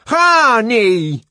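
high, loud yell; full-volume angry cry; shouted curse. A narrator's reading. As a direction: hurl it as a loud insult. Savage adds shouted Zuni curse.

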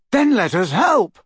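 high, loud, heated demand; angry Neville. A narrator's reading. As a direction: demand angrily to be included. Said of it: "said Neville angrily".

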